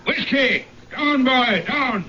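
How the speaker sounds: sharp high commands to a dog; calling the dog. Acted. Sir John orders his hound Whiskey down, high sharp commands.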